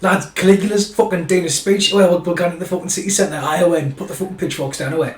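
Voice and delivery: high, loud, rushed excited pleb; excited commoner. Retold or imitated. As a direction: shout the news to the lads.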